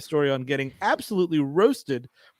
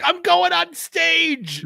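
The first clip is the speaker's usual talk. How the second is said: mock stage diva; 13 semitones higher, 5 dB louder, much slower than usual. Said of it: "I'm like".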